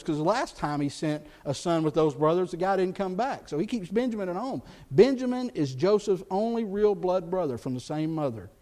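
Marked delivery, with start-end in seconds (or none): none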